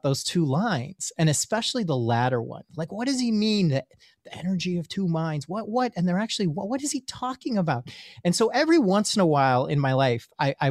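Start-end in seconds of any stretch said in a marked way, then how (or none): 2.8-7.9 high-pitched, acted-out bewilderment; baffled questioning. Student mulls over mystic's riddle, high baffled questioning. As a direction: puzzle it out aloud, pitched up in confusion.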